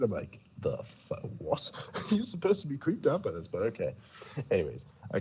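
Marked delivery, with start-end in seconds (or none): 0.6-1.7 retold confusion. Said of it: "so confused".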